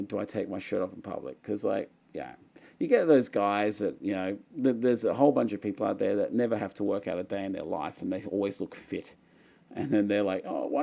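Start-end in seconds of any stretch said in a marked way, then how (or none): none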